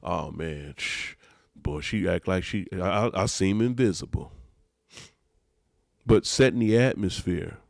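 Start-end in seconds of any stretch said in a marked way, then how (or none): 0.0-4.3 quiet grumble. Acted. Men talking about feeling invisible, quiet grumble. Men's private grumbling, acted.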